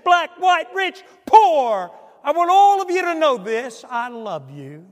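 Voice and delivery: loud, high, booming sermon climax; preaching at full force. Acted. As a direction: preach it out to the back row.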